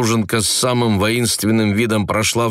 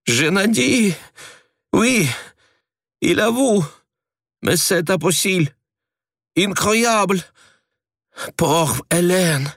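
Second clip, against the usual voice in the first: high, agitated French cries; distressed French exclaim; 10 semitones higher than usual. A narrator's reading. Asya clutching her head, distressed high French. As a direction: cry out in French, much higher.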